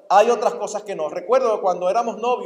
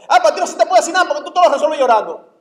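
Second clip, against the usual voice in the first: rapid, loud irritated complaint; fast exasperated snap; 6 semitones higher, 8 dB louder, much faster than usual. Retold or imitated. Husband losing patience with his crying wife, fast angry snap. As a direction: fire it off fast and loud, fed up.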